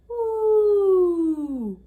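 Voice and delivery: loud ooh sliding steadily down; downward glide. Shown as a demonstration. Teacher showing how the shape ends, falling ooh. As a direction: let the voice fall to the bottom. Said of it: "going all the way down like this".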